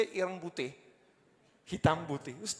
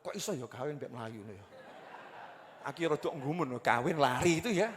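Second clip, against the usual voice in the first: ordinary talk, nothing marked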